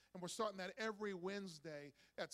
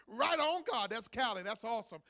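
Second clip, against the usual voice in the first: joyful cheer; 8 dB louder than usual. Retold or imitated. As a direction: cheer like a thrilled father. Daughter born, joyful cheer.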